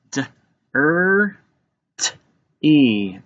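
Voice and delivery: "D, er, t, e" as four separate sounds; sounded out. Shown as a demonstration. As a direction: tap out each sound: "D, er, t, e", pauses between. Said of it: "Let's tap it out".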